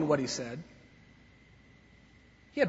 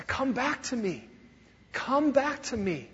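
tender plea; 6 semitones higher, 3 dB quieter than usual. Acted. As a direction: call them home gently. God pleading through prophets.

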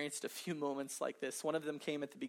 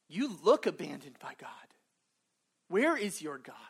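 taunting whisper; 5 dB louder than usual. Acted. Pagans taunting the psalmist.

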